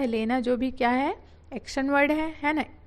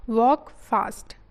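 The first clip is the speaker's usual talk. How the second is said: slow, louder reading; 5 dB louder, slower than usual. Shown as a demonstration. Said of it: "teacher reads the phrase".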